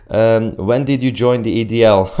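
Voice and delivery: ordinary talk, nothing marked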